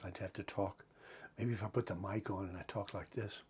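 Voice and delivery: very soft, hushed speech; hushed demo. Shown as a demonstration. So as not to wake sleeping wife, hushed. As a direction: show how quiet he'd have to be. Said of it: "go like this".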